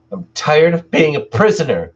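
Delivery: high, loud angry cry; furious outburst. Acted. Tina yelling at her partner as she throws the door open, furious. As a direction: yell it as you break free.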